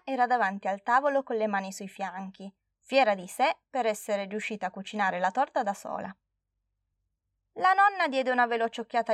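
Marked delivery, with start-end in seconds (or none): none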